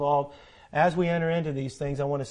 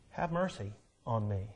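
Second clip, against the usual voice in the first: hushed plea; 7 semitones lower, 9 dB quieter than usual. Acted. Quiet plea voiced for David.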